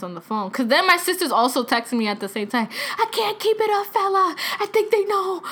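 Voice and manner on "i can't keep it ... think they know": high-pitched mock panic; paranoid sister. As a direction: imitate a sister about to crack under a secret.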